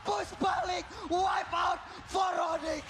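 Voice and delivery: slow drawn-out roaring hype; fighting game announcer. Real, not acted. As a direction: stretch every word for the crowd. Casters hype a team wipe.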